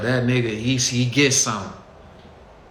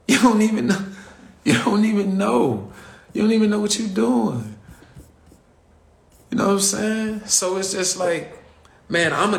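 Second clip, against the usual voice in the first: high-pitched rant; 8 semitones higher than usual. Real, not acted. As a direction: scold them up high. Ranting at rappers about business.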